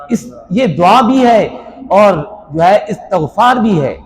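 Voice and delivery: usual speaking voice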